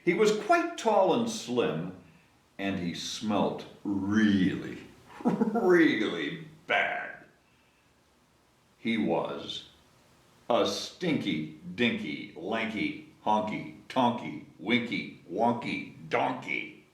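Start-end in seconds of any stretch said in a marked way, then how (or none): 2.6-7.1 laughing slowly. Acted. Laughing at the smelly donkey. 8.8-16.8 slow low recital. Acted. Slow low list of donkey names.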